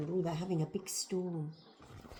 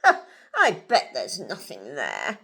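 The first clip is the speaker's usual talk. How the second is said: low, loud dismissive dad; big swaggering scoff; 7 semitones lower, 8 dB louder than usual. A narrator's reading. Narrator voices Dad striding onto the bridge, scoffing. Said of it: "and scoffed".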